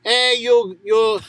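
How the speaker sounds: high scolding Greek granny; Greek grandmother. Acted. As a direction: wag a finger like a Greek yaya. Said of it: "a Greek grandmother".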